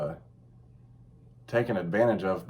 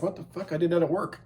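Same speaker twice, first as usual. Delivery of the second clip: shocked man, quoted; 6 semitones higher than usual. Retold or imitated. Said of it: "He's like".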